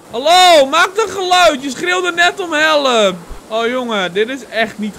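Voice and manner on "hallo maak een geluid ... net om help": loud, higher searching shout; calling out. Acted. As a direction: holler for a missing friend to answer. Calling for the lost friend.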